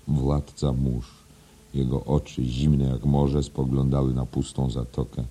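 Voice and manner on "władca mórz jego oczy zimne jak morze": usual speaking voice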